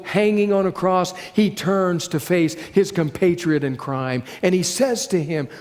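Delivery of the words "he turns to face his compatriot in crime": normal talking voice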